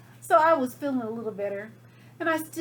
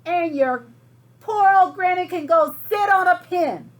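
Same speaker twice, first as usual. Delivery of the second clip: higher, much louder insult; pig yelling; 5 semitones higher, 11 dB louder than usual. Acted. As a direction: yell the insult at the wolf. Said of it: "the third little pig yelled".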